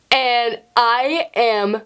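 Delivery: very slow, with long pauses and a whispered, stretched "so"; mortified, drawn out. Retold or imitated. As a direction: let each word hang in embarrassment. Recounts being caught by the teacher.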